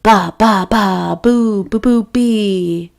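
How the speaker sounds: ordinary delivery, nothing marked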